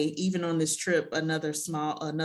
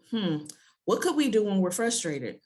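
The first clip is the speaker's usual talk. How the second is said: ordinary delivery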